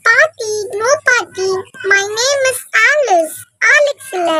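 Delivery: high, very slow insistent correction; girl correcting. Acted. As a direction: spell out her real name to granny. Girl corrects grandma's mispronunciation.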